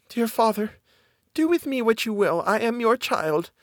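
put-on high, gentle girl's voice; miller's daughter, submissive. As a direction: pitch it high and yield meekly.

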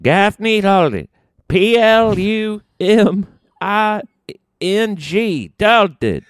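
put-on very high, slow old country codger; hammed-up old mechanic sales patter; Old Man Gaffney. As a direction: spell it out in a high, drawn-out Southern old-man voice. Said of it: "Old Man Gaffney".

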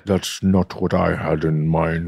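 very low, slow put-on voice; impersonating Rob. Friend joins in as the confused castmate, in deep slow impersonation. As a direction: draw it out deep and deliberate.

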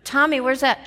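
usual speaking voice

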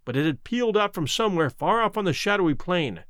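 usual speaking voice

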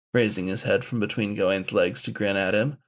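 ordinary delivery, nothing marked

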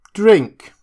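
loud, high one-word command; insistent order. A narrator's reading. Drunk doctor ordering Prendick to drink, loud and high. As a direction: push the glass at him. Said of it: "he said, returning".

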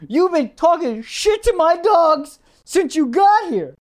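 imitating a worked-up man, high and slow; mock outraged accusation, drawn out and high; hyped-up. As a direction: stretch the accusation out indignantly. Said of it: "he's so hyped that he".

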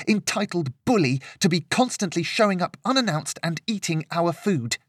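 high-pitched indignant rant; temper boiling over. A narrator's reading. Girl ranting about uninvited guest, boiling temper. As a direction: pile up the complaints, heated and high. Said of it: "could feel her temper starting to boil over".

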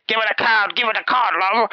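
high, brash beaver puppet voice; the beaver telling him to hand the wife the card. Retold or imitated. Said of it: "the beaver's like".

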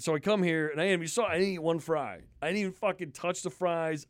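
usual speaking voice

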